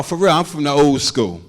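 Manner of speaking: normal talking voice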